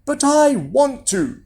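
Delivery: put-on high, drawn-out boyish protest; boy wheedling his mother, pitched high; insistent Jack. A narrator's reading. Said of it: "said Jack".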